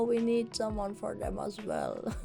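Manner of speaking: ordinary talk, nothing marked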